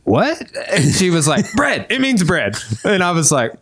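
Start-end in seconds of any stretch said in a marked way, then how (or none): 0.0-0.4 high questioning yelp; baffled. Retold or imitated. Man puzzled by the gym girl's answer, baffled. Acts out his own baffled reply. 1.6-2.6 high, mock exasperated answer; aggressive retort. Gym girl explaining the word, mock aggressive. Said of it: "real aggressively".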